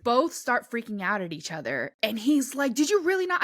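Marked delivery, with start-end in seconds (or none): 2.7-3.4 high disbelieving question; shocked he can't believe it; incredulous. Retold or imitated. Friend freaking out.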